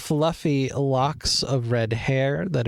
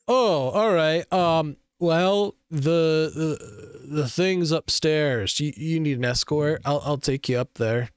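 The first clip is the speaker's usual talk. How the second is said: lightly voiced, stammering guard; a hint of a bashful guard; flustered guard; 3 semitones higher than usual. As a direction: fumble the words, smitten. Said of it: "And he goes".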